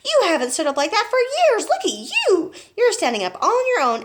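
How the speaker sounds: high, loud, excited praise; delighted cry. A narrator's reading. Boy amazed grandma stands without stick, delighted cry. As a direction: gush it out loudly, pitched high. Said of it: "George cried".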